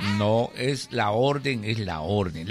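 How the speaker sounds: slow, low refusal; measured voice of Death; stern Death. Acted. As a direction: hold firm, slowly and deep.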